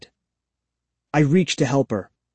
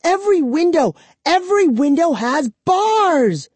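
high loud wail; 4 dB louder than usual. Acted. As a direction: wail it in frustration. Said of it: "she wailed".

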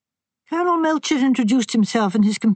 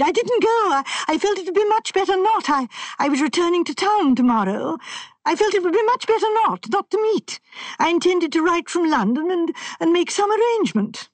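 much higher, rushed, agitated protest; high and excited; 9 semitones higher than usual. A narrator's reading. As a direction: let the voice climb in panic. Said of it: "Sanford's voice rose high and excited".

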